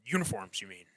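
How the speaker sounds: loud conspiracy-host impression; put-on ranting radio host, pressing the point; Alex Jones. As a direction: play a worked-up conspiracy pundit. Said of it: "Do your best Alex Jones impression".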